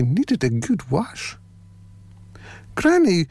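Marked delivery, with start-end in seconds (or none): 2.8-3.3 put-on high little girl calling granny. A narrator's reading. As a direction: call out as a wee girl, pitched high. Girl addresses her granny.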